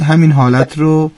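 usual speaking voice

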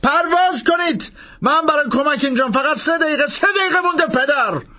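high, pressing shout; urgent shout. A narrator's reading. Langdon shouting at the priest over the rotors, high urgent shout. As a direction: hammer home the minutes left. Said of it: "فریاد زد".

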